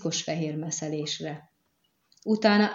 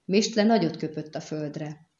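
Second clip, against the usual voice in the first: usual speaking voice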